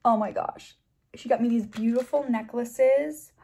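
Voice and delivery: ordinary talk, nothing marked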